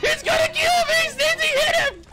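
screamed high in panic; panicked shout. Real, not acted. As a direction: shriek for help. Panicked shout while being chased.

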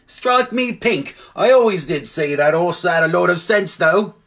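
high, put-on cabby voice; amazed cabby. Cabby marvelling at his talking horse, high character voice.

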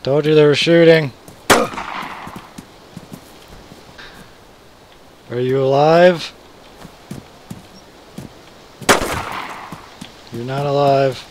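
loud call to teammate. Real, not acted. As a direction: call out across the map. Calling to teammate.

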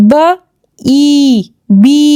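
ব, ই separately, ই drawn out as 'ee', then বি; long E sound. Shown as a demonstration. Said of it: "ই এর long sound হবে". (লম্বা 'ঈ' ধ্বনি)

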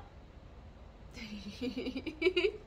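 breathy rising laugh; a real, rising laugh. Real, not acted. Laughing with the pet.

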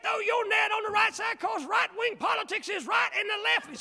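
high, loud far-off hellfire shout; mock a backwoods preacher yelling; ranting preacher. Preacher mocking a West Virginia hill preacher, loud high shout. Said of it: "somebody has preached this".

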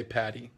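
Patty for Paris, soft tapped middle, no final s; mock French pronunciation. Shown as a demonstration. Coach teaching viewers the local name, Patty said French-style, softly. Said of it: "We say Patty".

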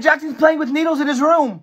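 ordinary talk

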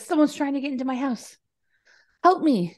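fast, high frightened plea; light mock panic on the phone; retold panicked caller. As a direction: play the scared girl begging for help. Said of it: "[name] says".

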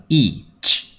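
each drawn out with a clear final ch; correct pronunciation of each. Shown as a demonstration. Teacher corrects students on each, slow with clear final ch. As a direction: stretch each slowly, finishing on "ch". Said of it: "có âm trở phía sau nhé".

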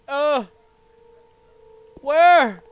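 sudden loud startled yelp. Real, not acted. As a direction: jump at the scare. Startled by jump scare.

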